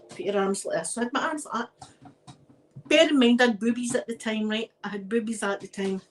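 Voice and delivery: ordinary talk, nothing marked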